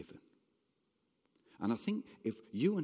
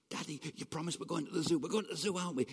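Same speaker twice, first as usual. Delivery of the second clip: put-on high, fast, pleading child; excited child; 4 semitones higher, much faster than usual. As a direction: pester Daddy in a rush of high-pitched eagerness. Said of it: "And there's that excitement in there".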